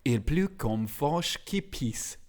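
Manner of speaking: slow French-accented phrase. Shown as a demonstration. Sharing a French idiom with the co-host, slow French accent. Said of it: "I'm gonna do my French accent now".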